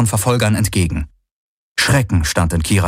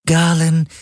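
lightly voiced artificial companion, higher pitch; Schattenhelix; 8 semitones higher than usual. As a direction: address him in a higher, level device voice. (leicht verstellte, höhere Helix-Stimme)

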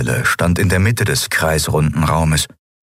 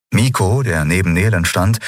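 ordinary delivery

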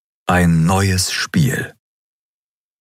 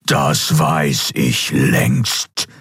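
very slow, distorted imperial rumble; thundering distorted voice; 3 semitones lower, much slower than usual. A narrator's reading. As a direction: thunder slowly through a distorted voice. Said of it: "donnerte der Kaiser". (donnernde, verzerrte Kaiserstimme)

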